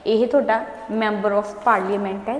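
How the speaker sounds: slow, careful explaining. Shown as a demonstration. Teacher slowly explaining MP to her pupils. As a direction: spell out the abbreviation slowly. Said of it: "ਨਾਲ ਨਾਲ ਬੋਲ ਵੀ ਰਹੀ ਹਾਂ".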